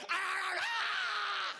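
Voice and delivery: high mock child screaming; hammed-up kid tantrum at the store; tantrum screams. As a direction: scream like a toddler to get the sale.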